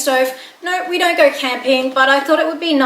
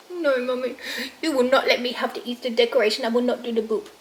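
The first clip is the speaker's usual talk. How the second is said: lightly voiced stubborn pet, a bit softer; sulky dog voice; 4 dB quieter than usual. Mother voicing her dog refusing the boop, sulky. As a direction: refuse like a pouting puppy.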